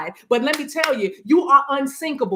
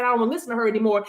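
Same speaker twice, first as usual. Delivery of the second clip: fast, huffy brush-off; rushed annoyed quote; much faster than usual. Pretend. Listener triggered by her, rushing out a huffy brush-off. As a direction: toss it off quickly, fed up. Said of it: "getting triggered and saying".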